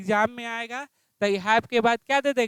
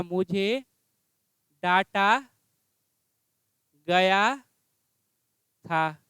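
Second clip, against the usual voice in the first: slow dictation; 4 semitones lower, slower than usual. Shown as a demonstration. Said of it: "क्या हो जाएगा?".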